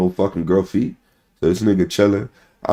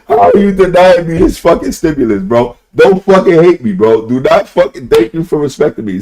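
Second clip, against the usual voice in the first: higher, louder playful plea; half-joking appeal to the audience; mock pleading; 8 semitones higher, 5 dB louder than usual. Real, not acted. Streamer pleads with chat not to hate him, loud and playful.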